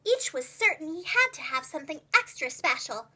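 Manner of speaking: usual speaking voice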